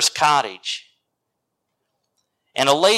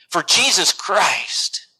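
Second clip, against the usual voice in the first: ordinary delivery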